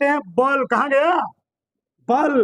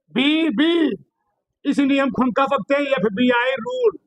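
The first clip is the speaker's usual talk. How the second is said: ordinary talk, nothing marked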